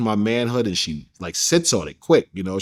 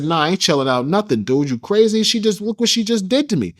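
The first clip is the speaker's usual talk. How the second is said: retold furious outburst; 8 semitones higher than usual. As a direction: refuse to calm down, outraged. Retold shouting back at [name].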